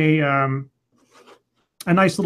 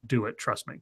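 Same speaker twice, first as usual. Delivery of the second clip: calm quiet command; 4 semitones lower, 11 dB quieter than usual. Retold or imitated. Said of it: "And Ramius is like".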